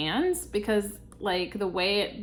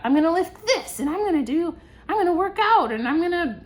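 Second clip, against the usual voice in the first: higher, louder gung-ho list; eager plans; 7 semitones higher, 5 dB louder than usual. Retold or imitated. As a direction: pump yourself up. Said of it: "I remember being very much like that".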